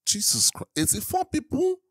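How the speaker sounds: high, incredulous exclamations; reeling, voice leaping high; shocked disbelief. Real, not acted. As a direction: blurt it out in rising disbelief. Still reeling from death toll.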